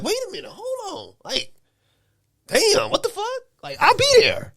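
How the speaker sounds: high, put-upon voice hurrying to agree; mock flustered wife. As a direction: play the startled wife caught off guard. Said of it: "You gonna be like".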